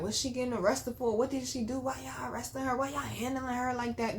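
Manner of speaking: imitating an indignant bystander; ex-fiance protesting. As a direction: fire off questions as an upset partner. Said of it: "he was like".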